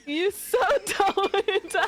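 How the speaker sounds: slow and laughing; slow laughing tease. Real, not acted. Teasing, slower, laughing.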